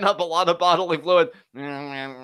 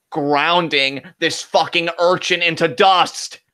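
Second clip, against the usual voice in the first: ordinary talk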